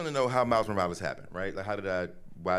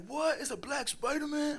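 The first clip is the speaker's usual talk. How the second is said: put-on amazed kids; slower than usual. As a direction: shriek it like a stunned teenager. Boys react in disbelief.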